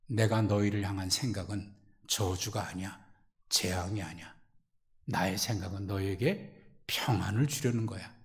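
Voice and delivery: God soothing His people softly; tender reassurance. Acted. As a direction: comfort them quietly. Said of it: "하나님이 ... 하신 말씀이".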